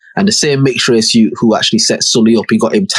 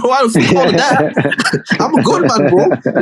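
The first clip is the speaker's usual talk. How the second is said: high, laughing mock voice of a friend; 12 semitones higher than usual. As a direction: squeak it out while laughing.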